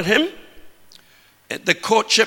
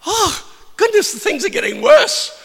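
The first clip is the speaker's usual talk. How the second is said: mock alarm; 13 semitones higher than usual. Preacher dramatizing wedding crisis.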